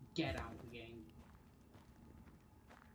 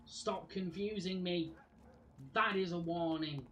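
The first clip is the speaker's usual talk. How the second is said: louder, higher angry threat; angry warning; 5 semitones higher, 7 dB louder than usual. Real, not acted. Gamer threatening the confusing game, angry and loud. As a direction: warn the game like you mean it.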